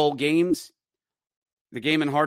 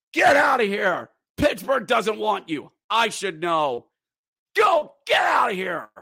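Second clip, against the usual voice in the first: mild retold yell, higher; yelling at fans; 7 semitones higher, 3 dB louder than usual. Announcer retelling yelling at rival fans, mild mock yell. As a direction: shout them out of the arena. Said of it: "And I am yelling".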